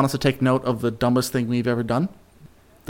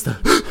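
pre-sneeze gasp; 3 semitones lower than usual. Retold or imitated. Acting out pre-sneeze inhale.

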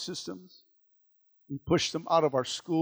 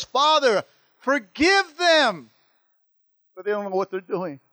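pleading prayer; 5 semitones higher, 7 dB louder than usual. Acted. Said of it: "looks towards heaven and says".

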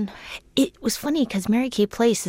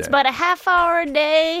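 excited echo; 8 semitones higher, 5 dB louder than usual. Real, not acted. Echoes host, higher and louder.

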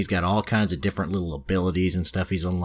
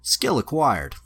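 higher-pitched lightly imitated system announcement; game notification; 6 semitones higher, slower than usual. Game telling the hero of a new skill, announcer-like. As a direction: call it out like an on-screen alert. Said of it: "as he's doing that, it says".

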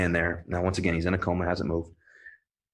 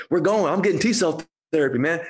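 excited patient; 9 semitones higher, 4 dB louder than usual. Retold or imitated. Patient fired up to doctor.

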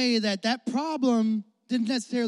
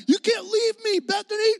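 high, pleading mock plea to a girlfriend; dramatic heartbroken boyfriend; 11 semitones higher, 3 dB louder than usual. Travis begging his girlfriend not to leave, mock pleading. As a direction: ham up a dumped guy begging. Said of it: "he was like".

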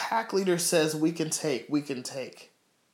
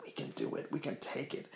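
near-whispered affirmation; hushed; 4 semitones lower, 16 dB quieter than usual. Real, not acted. Preacher affirming faith to God in prayer, hushed. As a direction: drop right down to a soft, breath-light murmur.

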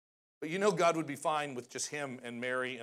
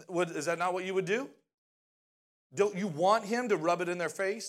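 usual speaking voice

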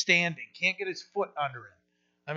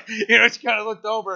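higher, louder, laughter in the voice; laughing; 7 semitones higher, 8 dB louder than usual. Real, not acted. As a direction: talk on through your laugh. Still laughing at wife's reaction.

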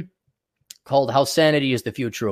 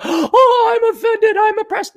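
high, whiny parody of a victim; mocking squeal of fake outrage; mock offended whine; 4 dB louder, slower than usual. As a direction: sneer it out in a prissy falsetto.

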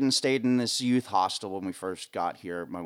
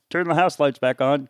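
loud, raised call across the venue; a crew cue called out loud; 5 semitones higher, 9 dB louder than usual. Retold or imitated. Stagehand calling to crew.

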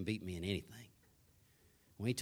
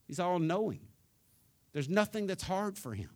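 high, ringing preacher declarations; preaching at full force; 6 semitones higher, 3 dB louder than usual. Real, not acted. Preacher telling the church God is able, ringing proclamation. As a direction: proclaim each line like a banner.

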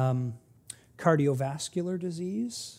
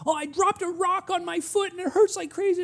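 put-on high, loud upset child voice; mimicking a crying, angry child; child yelling; 13 semitones higher, 3 dB louder than usual. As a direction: wail it like a hurt five-year-old. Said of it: "they're kinda yelling at me".

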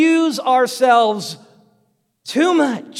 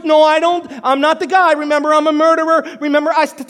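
high, loud frantic refusal; Moses protesting; 4 semitones higher, 5 dB louder than usual. Acted. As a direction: argue with God at high pitch, insistently. Acts Moses refusing at burning bush.

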